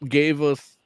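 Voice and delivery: ordinary talk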